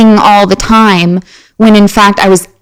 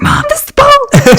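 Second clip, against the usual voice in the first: very high-pitched, hammed-up amazement; squealing excited announcement to mom; freaked out. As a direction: act out a kid stunned by a find. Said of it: "I, like, freaked out".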